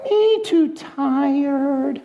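high, drawn-out sleepy piglet whine; sleepy baby pig puppet. Acted. Puppet woken by the puppeteer, high sleepy whine. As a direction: whine it slowly, high, like a tired little puppet. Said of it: "the pig was always asleep when she brings it out".

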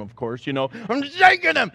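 normal talking voice

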